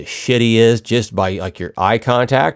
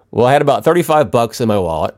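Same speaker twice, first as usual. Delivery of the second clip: ordinary delivery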